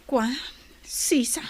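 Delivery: whispered name; whispered name, hushed. Acted. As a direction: breathe the name unvoiced.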